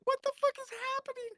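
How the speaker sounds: very high, fast squeal of disbelief; bewildered. Retold or imitated. Teller acting out watching the quarrel, bewildered.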